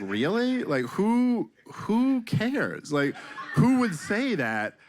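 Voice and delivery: high, disbelieving and laughing; incredulous. Retold or imitated. Comic answering a heckler, high and incredulous. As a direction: sell the disbelief, climbing up. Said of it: "I was like".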